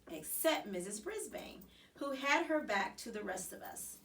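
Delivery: ordinary delivery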